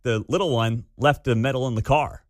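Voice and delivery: ordinary talk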